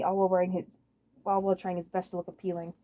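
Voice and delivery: plain reading voice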